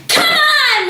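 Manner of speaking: high, loud rallying call; bold cry. A narrator's reading. Matilda bursting into the room, rallying cry. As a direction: lead the charge. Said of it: "Matilda cried".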